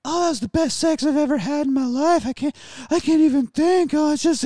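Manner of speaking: high, breathless mock female voice; hammed-up post-sex swoon; swooning lover. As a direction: gush in a dazed falsetto. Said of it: "she's gonna be laying there like".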